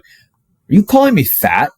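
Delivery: high, slow, offended question. Retold or imitated. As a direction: demand it, insulted. Confronting parents, offended.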